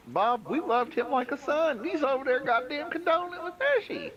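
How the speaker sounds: high, hammed-up drawling rant; mock Southern good-old-boy voice; mock old Southern blowhard. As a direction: ham up a folksy old windbag. Mocking a Southern preacher figure.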